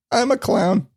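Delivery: squeaky voice break; voice cracking. Real, not acted. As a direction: voice cracks up high. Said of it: "Don't know how the fuck I did that to my voice".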